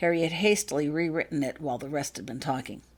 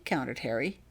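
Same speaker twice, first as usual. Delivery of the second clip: plain reading voice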